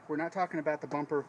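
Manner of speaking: ordinary talk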